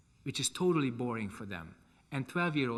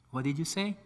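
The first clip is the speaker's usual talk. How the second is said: raised voice, a mild mock call; calling over noise; 4 semitones higher, 4 dB louder than usual. Teacher asking shouting kids to repeat themselves, mildly raised call. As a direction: ask it up over a noisy class.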